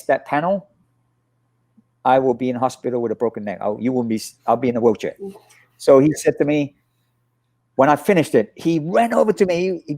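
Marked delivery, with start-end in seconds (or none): none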